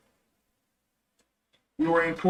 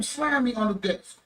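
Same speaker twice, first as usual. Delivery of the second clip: ordinary delivery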